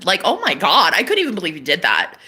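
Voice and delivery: usual speaking voice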